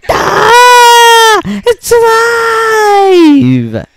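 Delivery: long, very high, loud scream of shock; horror-struck comic shriek; terrified yell. Acted. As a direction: scream it out, stretching the last word. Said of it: "The man yelled".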